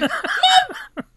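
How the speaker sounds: high laughing shout of Mom!; shocked cry. Real, not acted. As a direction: burst out in disbelief, laughing.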